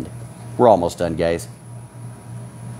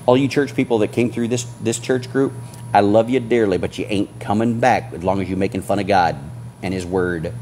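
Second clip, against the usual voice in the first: ordinary delivery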